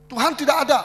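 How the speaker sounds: imitating a shouting unbeliever; atheist yelling. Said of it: "dia teriak-teriak".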